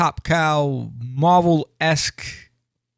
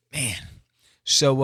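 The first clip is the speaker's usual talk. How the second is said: ordinary talk, nothing marked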